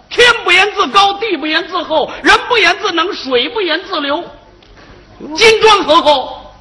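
high-pitched, declamatory classical recitation; quoting the ancients. Acted. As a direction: intone it like an ancient maxim, line by line. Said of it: "古人说得好".

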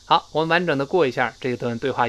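ordinary delivery

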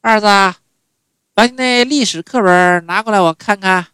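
high, loud mother calling her son. Retold or imitated. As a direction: call out like mom from her room. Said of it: "每天都得叫我".

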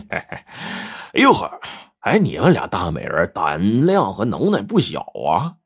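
villain's sly laugh into mocking admiration; snicker, then taunt with swooping pitch; creepy, gloating sneer; sinister chuckling. A narrator's reading. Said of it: "白胖子就阴笑道".

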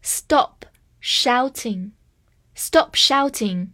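slow, careful repetition; slow repeat. Shown as a demonstration. Teacher repeating a sentence slowly for the class. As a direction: say it slowly for learners to copy. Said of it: "好，再来一遍".

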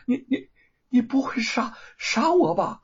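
high, stammering, half-whispered plea; trembling fear. Acted. The thief pleads at swordpoint, trembling. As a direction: beg for life with the sword at her throat. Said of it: "浑身颤抖着".

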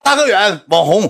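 high, loud fan shout of his name; excited fan calling. Retold or imitated. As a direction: call out like a starstruck fan.

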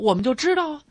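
usual speaking voice

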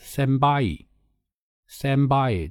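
senbai said slowly as a Japanese word; senbai, slow Japanese syllables; Japanese pronunciation. Shown as a demonstration. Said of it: "日文就叫什么呢".

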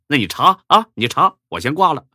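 loud fed-up snap; exasperated. Acted. Exasperated friend ends the call.

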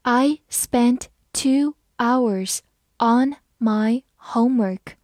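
slow, stretched-out read. Shown as a demonstration. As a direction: read it slowly for learners. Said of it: "我们慢慢来读". (慢速朗读)

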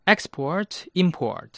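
English "export、 import" with stress on ex- and im-; first-syllable stress. Shown as a demonstration. Teacher shows English influence, "export、 import" stressed on first syllables. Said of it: "重音都是在第一个音节".

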